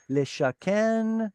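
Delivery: slow, careful "leshaken"; careful Hebrew. Shown as a demonstration. Teacher slowly modelling "leshaken" for the class. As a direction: sound out "leshaken" slowly, syllable by syllable.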